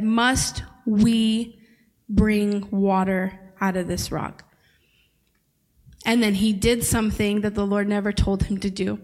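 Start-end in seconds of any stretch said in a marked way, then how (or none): 0.0-4.4 "we" set apart by a pause after it; pointed, broken-up quote leaning on "we"; stress on WE. Shown as a demonstration. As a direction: hit "we" hard, then pause before "bring". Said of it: "he made a big mistake because he said".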